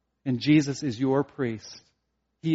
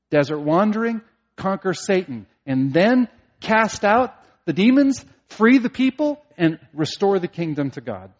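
building excitement; 4 semitones higher, 6 dB louder than usual. Acted. Listing Christ's kingly tasks.

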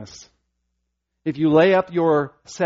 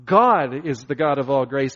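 ordinary talk

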